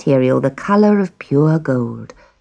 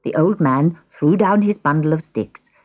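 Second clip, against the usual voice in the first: plain reading voice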